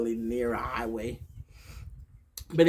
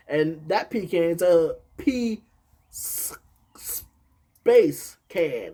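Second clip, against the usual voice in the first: slow "P", then louder "space can"; broken-up word; 3 semitones higher, 6 dB louder, slower than usual. Shown as a demonstration. Explaining the word pecan, "P space can" broken apart. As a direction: separate "P", "space" and "can" deliberately. Spells out the pronunciation as joke.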